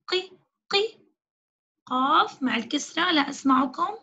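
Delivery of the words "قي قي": "قي" as a short, high qi, repeated; qaf with kasra. Shown as a demonstration. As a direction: sound out "قي" with an i vowel, a gap between repeats. (نطق "قي" بالكسرة بنبرة عالية)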